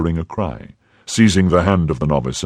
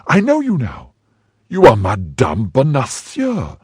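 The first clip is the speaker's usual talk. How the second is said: much higher, ringing triumph; triumphant recognition; 8 semitones higher than usual. A narrator's reading. Milady unmasking Madame Bonacieux, high and triumphant. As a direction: pounce on the recognition.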